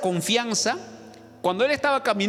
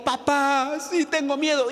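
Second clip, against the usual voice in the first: put-on higher child voice, afraid; scared daughter; 7 semitones higher, slower than usual. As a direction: answer like a frightened little girl. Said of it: "la hija dijo". (niña asustada con voz aguda)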